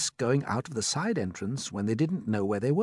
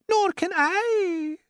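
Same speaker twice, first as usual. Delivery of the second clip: very high, loud, slow wail; 6 dB louder, much slower than usual. A narrator's reading. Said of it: "wailed the glowworm".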